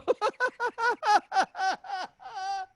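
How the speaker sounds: real high-pitched laughing fit; helpless laughter. Real, not acted. Co-host cracking up at drinking from a creek through a straw, high laughter. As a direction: burst out in a long high laugh.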